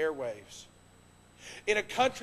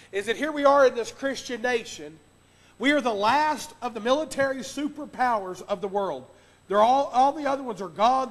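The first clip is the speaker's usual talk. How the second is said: raised, louder pulpit delivery; forceful preaching; 4 semitones higher, 7 dB louder than usual. Real, not acted. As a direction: drive each phrase home to the congregation.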